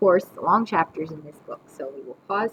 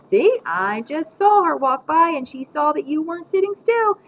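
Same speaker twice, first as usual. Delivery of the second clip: lightly voiced scolding grandma, higher and louder; calling across room; 9 semitones higher, 7 dB louder than usual. A narrator's reading. Grandma chiding the wiggling boy from her crosswords, scolding. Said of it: "say from across the room".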